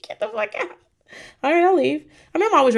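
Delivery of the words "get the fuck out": breathy laughing whisper; mock whispered get out; whispered mock dismissal. Imagined hiss at herself.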